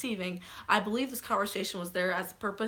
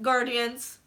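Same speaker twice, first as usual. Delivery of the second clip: ordinary talk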